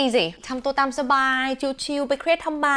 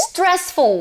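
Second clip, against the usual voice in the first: high careful demo; 7 semitones higher than usual. Shown as a demonstration. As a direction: announce "stressful" brightly with a fall. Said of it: "stressful เอาไว้ใช้กับ".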